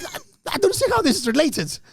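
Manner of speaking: stammering, high-pitched objection; baffled protest. Retold or imitated. Comedian answering the accusing old man, baffled protest. Comic protest at being blamed.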